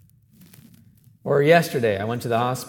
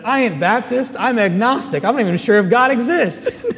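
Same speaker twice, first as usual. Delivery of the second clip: amused retelling; 10 semitones higher, 7 dB louder than usual. Retold or imitated. Hospital clerk's blunt reply retold.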